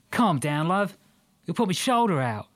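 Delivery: high, strained Australian voice; wincing Aussie, arm twisted; Australian man in pain. Acted.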